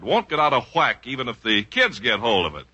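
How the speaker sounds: normal talking voice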